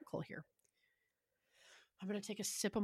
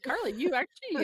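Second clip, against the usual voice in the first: mock algorithm voice; 7 semitones higher, 6 dB louder than usual. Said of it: "It says".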